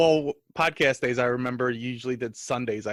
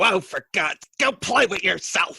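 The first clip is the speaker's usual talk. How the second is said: imitating angry mom; 5 semitones higher, slower than usual. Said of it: "She just would get angry and go".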